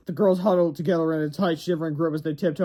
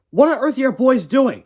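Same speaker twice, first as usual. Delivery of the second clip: much higher, louder puzzled mother; mom voice; 9 semitones higher, 7 dB louder than usual. Acted. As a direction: mom calling from the yard. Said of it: "said Harold's mother".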